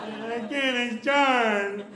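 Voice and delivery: put-on very slow, high, loud child's voice; small boy correcting the teacher; little kid insisting. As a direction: insist on Chard like a stubborn five-year-old. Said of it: "He can't say his own name".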